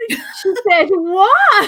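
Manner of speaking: high, slow, laughing shocked outburst; a reader scandalised by a plot twist, high and slow; shocked disbelief. Retold or imitated. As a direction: gasp it out in stunned delight, laughing. Reacting to a shock in book.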